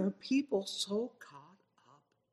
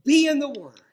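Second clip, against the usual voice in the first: loud, higher preaching cry; exhorting shout; 9 semitones higher, 13 dB louder than usual. Acted. Preacher exhorting his congregation to read scripture. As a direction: call it out like a command. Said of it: "I exhort you".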